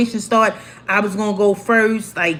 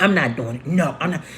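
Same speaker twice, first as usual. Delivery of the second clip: put-on lower, sulky spoiled girl; spoiled refusal; 5 semitones lower, 3 dB quieter, faster than usual. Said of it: "I'm like".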